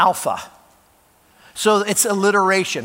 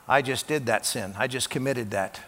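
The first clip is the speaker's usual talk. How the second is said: quiet inner thought; 6 semitones lower, 8 dB quieter than usual. Retold or imitated. Congregant's guilty inner thought.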